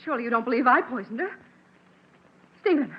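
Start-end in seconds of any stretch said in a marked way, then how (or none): 2.6-2.9 rising scream of no; horrified denial. Acted. Accused wife screams denial at husband, horrified. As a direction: cry out as he turns on you.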